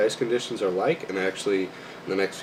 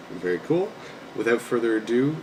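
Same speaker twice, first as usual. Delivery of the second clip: ordinary talk, nothing marked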